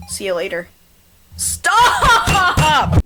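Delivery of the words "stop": high, loud long scream. Acted. Final scream.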